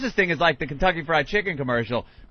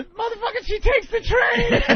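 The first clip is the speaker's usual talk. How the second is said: high-pitched mocking voice; squeaky sneering put-on voice. Mocking voice of onlookers.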